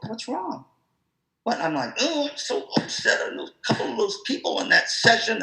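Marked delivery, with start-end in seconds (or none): 0.0-0.6 a light, higher, softer voice for Andrea; softly asked what's wrong; concerned colleague. Retold or imitated. Colleague voiced asking him. 2.0-5.4 hammed-up complaining at a higher pitch; imitating his own upset grumble; mock upset whine. Retells himself being upset.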